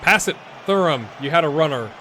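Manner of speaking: loud, frustrated shout at a player; yelling from the sidelines. Real, not acted. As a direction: yell at him for missing the pass.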